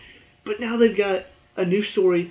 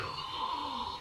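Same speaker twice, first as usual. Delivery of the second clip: sharp high gasp of shock; 10 semitones higher, 15 dB quieter than usual. Retold or imitated. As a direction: gasp as if betrayed. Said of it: "I'm like".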